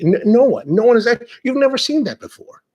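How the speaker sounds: heated, indignant protest; exasperated rant. Retold or imitated. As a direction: rant at the judge in disbelief. Pissed off ranting at judge.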